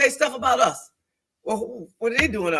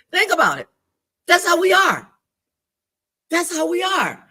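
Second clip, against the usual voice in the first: preaching emphasis; 9 semitones higher, 4 dB louder than usual. Real, not acted. Preaching point to congregation.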